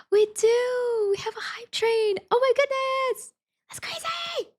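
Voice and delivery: very high, drawn-out excited squeal; gasp, then squeal with delight; thrilled squeal. Real, not acted. Streamer spots a hype train for her channel, thrilled squeal.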